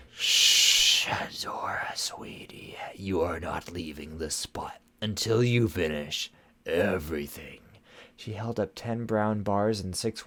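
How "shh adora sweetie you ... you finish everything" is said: menacing whisper. Acted. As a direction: hush her like a threat. Shadow Weaver hushing menacingly.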